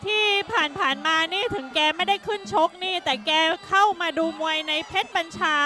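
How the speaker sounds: ordinary talk, nothing marked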